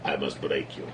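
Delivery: low, soft menacing Drago line; lightly voiced Russian boxer threat; Drago impression. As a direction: growl it like Ivan Drago from Rocky. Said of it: "a Norwegian guy saying".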